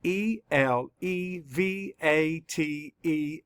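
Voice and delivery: slow, letter-by-letter spelling; measured classroom spelling; spelling aloud. Shown as a demonstration. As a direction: spell it out slowly, each letter separate. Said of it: "let's spell it".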